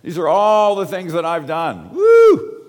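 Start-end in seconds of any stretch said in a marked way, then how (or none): 1.9-2.6 high, loud sarcastic whoop; a hammed-up victory whoop; mock cheer. Mock cheer for own achievements.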